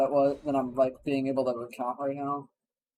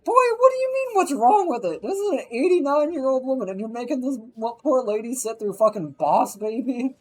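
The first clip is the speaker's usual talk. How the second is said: high, loud imitation of an outraged dad; hammed-up furious father; indignant dad; 9 semitones higher, 3 dB louder than usual. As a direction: scold him like the old lady's being tortured. Dad scolding Tom.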